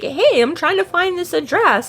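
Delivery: imitated customer. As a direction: raise the pitch for an enquiring stranger. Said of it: "somebody comes into my place of business".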